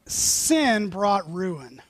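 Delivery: very slow, weighted words; slow solemn. Real, not acted. Preacher to congregation, slow and grave. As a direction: let each word fall heavily.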